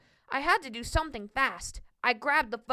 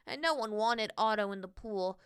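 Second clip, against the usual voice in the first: usual speaking voice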